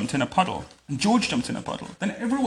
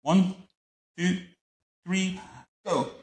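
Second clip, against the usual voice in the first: higher, spaced-out counting call; shouted countdown; 6 semitones higher, slower than usual. A narrator's reading. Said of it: "shouted Pepper".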